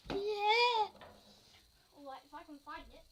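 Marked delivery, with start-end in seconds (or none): 0.1-0.9 high, loud cheer; exclaimed shout. Real, not acted. Calling out during gun display.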